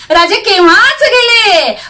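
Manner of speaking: high, loud triumphant cry; triumphant exclamation. A narrator's reading. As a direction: proclaim it loudly to the enemy. Captive repeats it triumphantly, louder.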